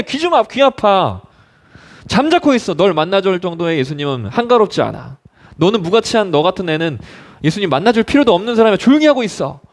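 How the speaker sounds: acted contemptuous rebuke; scolding crowd. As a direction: tell the beggar off, pitch jumping up. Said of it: "주변에 있었던 많은 사람들이".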